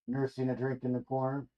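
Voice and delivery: ordinary delivery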